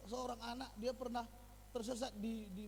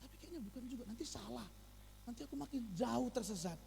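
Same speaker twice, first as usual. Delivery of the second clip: put-on unsure child; 6 dB quieter than usual. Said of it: "didengar anaknya".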